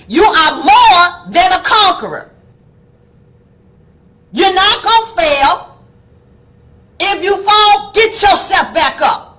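preacher raising her voice to wake them; elevated voice. Real, not acted. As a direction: lift the voice to get attention. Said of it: "my voice begins to elevate".

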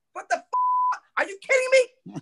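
incredulous sideline rant; exasperated cursing. Retold or imitated. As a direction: pitch climbing high in disbelief. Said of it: "cursing the guys out on the sideline to myself".

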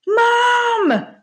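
high, drawn-out shout for Mum; shouted call. A narrator's reading. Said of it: "shouted Mungo".

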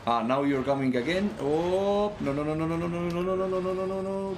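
high, loud panicked no no no; being chased. Real, not acted. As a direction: panic as they come again. Monsters attacking again.

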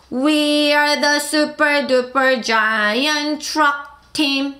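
very slow, word by word; teacher slowly reading a song line; slow lyric reading. Shown as a demonstration. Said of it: "시작".

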